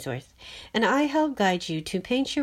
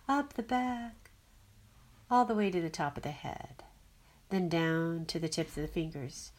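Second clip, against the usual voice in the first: guided meditation; 4 dB quieter than usual. Acted. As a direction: ease them into breath and grounding.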